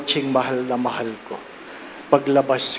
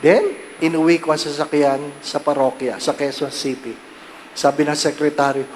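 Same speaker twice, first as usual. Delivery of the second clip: ordinary delivery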